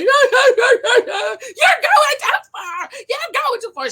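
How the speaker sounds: imitating a crying wife, hammed-up; mock crying. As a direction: wail it like she's breaking down in tears. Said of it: "getting emotional, start crying".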